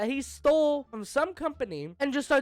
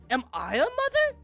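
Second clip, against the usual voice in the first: much higher squeaky question; high confused; 10 semitones higher, 4 dB louder than usual. Shown as a demonstration. Streamer replaying Kyle's confused question, much higher. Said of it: "I like how he goes from".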